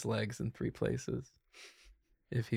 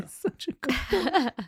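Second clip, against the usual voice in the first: doting pet talk; 4 dB louder, slower than usual. Real, not acted. Doting on the dog.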